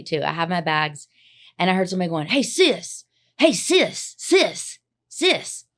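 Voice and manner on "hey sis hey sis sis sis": high-pitched falling calls to a stranger; imitated calling out. Retelling a man calling after her on the street, imitated high calling. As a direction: call out "sis" high, over and over.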